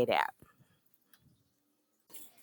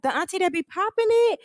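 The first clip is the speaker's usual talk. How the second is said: high, louder teasing question; mock gossip question; 13 semitones higher, 4 dB louder than usual. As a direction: needle her like gossiping girls. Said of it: "And they like".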